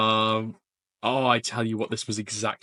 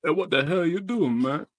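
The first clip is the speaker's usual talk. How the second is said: higher-pitched Southern challenge; Southern accent; 5 semitones higher than usual. Acted. Streamer repeating the challenge, higher Southern accent. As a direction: call him out in a raised Southern voice. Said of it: "I was doing a southerner".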